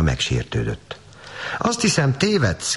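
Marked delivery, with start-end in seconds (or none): 1.6-2.8 put-on high, sharp little-girl protest; indignant child's cry. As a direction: cry out like an offended little sister. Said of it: "kiáltotta". (magas, sértődött kislánykiáltás)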